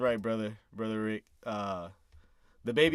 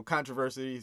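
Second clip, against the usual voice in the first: ordinary delivery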